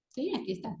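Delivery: usual speaking voice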